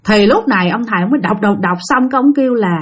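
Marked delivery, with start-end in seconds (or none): none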